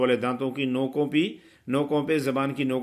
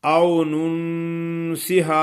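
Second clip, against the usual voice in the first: slow chant with the waw lightly held; tajweed recitation; 3 semitones higher, 3 dB louder, much slower than usual. Acted. Teacher reciting for students, slow chant. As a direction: recite او ننسہا slowly.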